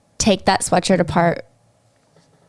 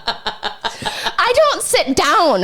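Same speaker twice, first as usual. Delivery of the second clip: laughing; 8 semitones higher, much slower than usual. Real, not acted. Laughing, drawn out.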